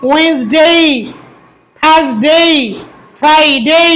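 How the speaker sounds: day words with a full, drawn-out "ey" glide at the end; correct English pronunciation. Shown as a demonstration. Teacher modelling weekday names for learners, carefully correct. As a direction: say "ডে" as a long diphthong "dey". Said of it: "আমরা যদি শুদ্ধ করে বলি".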